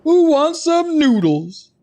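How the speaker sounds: copying the NPC. Retold or imitated. Streamer mocking how the vendor says it, high slow mimicry. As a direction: sing it out like the noodle guy. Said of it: "Why does he say it like that?".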